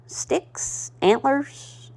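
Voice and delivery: breathless whisper of the word; whispered aside. Real, not acted. Crafter naming stash items, whispered. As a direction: drop to a whisper.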